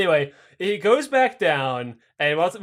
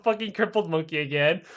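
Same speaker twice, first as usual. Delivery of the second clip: ordinary delivery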